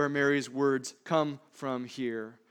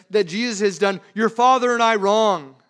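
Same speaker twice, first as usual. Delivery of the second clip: frustrated; 3 semitones higher, 9 dB louder than usual. Retold or imitated. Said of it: "In her frustration".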